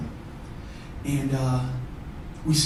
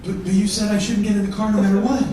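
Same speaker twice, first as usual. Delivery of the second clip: higher, stammering protest; hesitant wife; 5 semitones higher than usual. Retold or imitated. Wife recalling her husband's warning, high and hesitant. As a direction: object timidly in a raised pitch.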